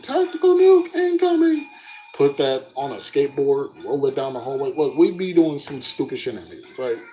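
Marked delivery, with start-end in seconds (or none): 0.0-1.7 much higher, louder, like a game speaker; game announcer line. Retold or imitated. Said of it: "I played that freaking".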